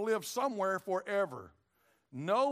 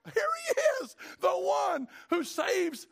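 shouted very high, then slow and loud; joyful shout; 11 semitones higher, 6 dB louder, much slower than usual. Retold or imitated. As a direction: cry out in joy at the baby. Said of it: "he's like".

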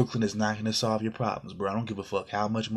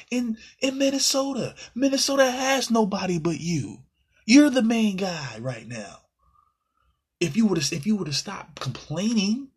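much higher, louder, almost crying out in disbelief; exasperated rant; 12 semitones higher, 5 dB louder than usual. Real, not acted. Fan venting at the player for wanting Brooklyn, high-pitched and loud in disbelief. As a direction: cry it out in high-pitched frustration.

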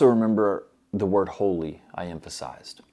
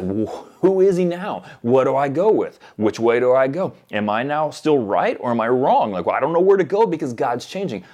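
higher, louder bewildered questioning; exasperated, pitched-up confusion; mock confusion; 4 semitones higher, 5 dB louder than usual. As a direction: act out someone lost and unsure which way to turn. Acting out confusion if God changed.

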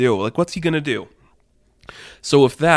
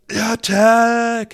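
much higher, louder shouted charge; battle cry; 11 semitones higher, 5 dB louder than usual. Retold or imitated. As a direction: yell it like ordering a charge. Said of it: "all you hear from Judas is".

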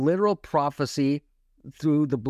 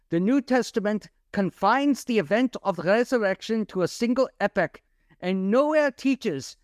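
put-on quoting a scholar in a raised German accent; 7 semitones higher than usual. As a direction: read the quote like a German professor. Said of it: "I had to put it in a German accent".

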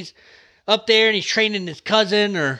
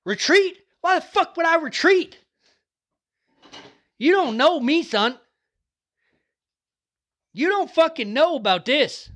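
fired-up yelling; 9 semitones higher than usual. Real, not acted. Vlogger hyping viewers never to retreat, fired-up yelling. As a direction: fire up the crowd like a fight-night hype man.

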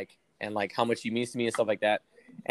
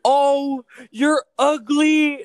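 high, slow, loud mock voice; high sneering put-on voice; mocking quote; 13 semitones higher, 12 dB louder, much slower than usual. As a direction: drag out ugly in a high sneer. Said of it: "she goes".

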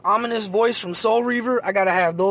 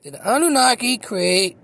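usual speaking voice

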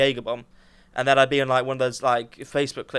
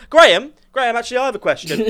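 loud call from the audience; 8 semitones higher, 6 dB louder than usual. Pretend. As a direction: heckle to get the host's attention. Heckling the host from the audience.

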